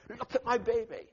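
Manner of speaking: high, loud and thrilled; excited mother calling out; proud call. Retold or imitated. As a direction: show off the new baby across the street. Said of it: "she's saying".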